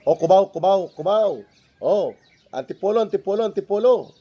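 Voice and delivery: high, loud barker calling out stops; hoarse jeepney route shouts. Retold or imitated. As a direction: call out destinations like a jeepney barker. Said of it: "tumatawag po dyan na mga sumisigaw ng".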